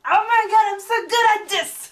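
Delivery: high, loud, thrilled with herself; crowing over a correct guess; excited. Real, not acted. Proud of guessing the plot right.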